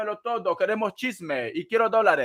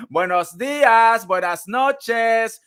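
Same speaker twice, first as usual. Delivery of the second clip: drawn-out greeting; 4 semitones higher, 6 dB louder, much slower than usual. Acted. Sing-song greeting to viewers.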